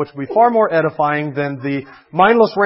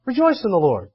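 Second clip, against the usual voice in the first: glib cheer; 3 semitones higher than usual. Retold or imitated. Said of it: "Walk up to somebody who just lost".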